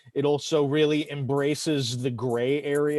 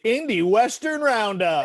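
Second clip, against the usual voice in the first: announcer shout; 6 semitones higher, 4 dB louder, slower than usual. Acted. Announcing the segment title.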